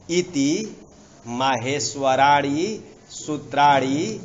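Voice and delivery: loud, drawn-out Sanskrit dictation; stretched syllables, loud enough for note-takers; slow dictation. Shown as a demonstration. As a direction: dictate it slowly, phrase by phrase. Teacher dictates a line to write.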